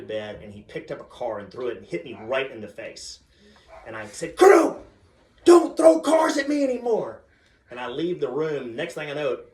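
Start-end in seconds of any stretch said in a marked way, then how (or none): 4.3-7.2 high, very loud scolding yell; angry shout. Retold or imitated. Dad hit by toy car scolds son, angry shout. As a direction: yell at the kid at full volume.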